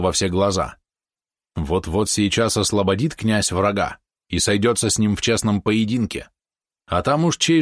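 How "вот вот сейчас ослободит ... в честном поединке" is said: ordinary delivery, nothing marked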